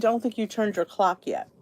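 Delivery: normal talking voice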